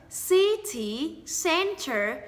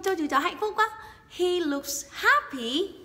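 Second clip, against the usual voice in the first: ordinary talk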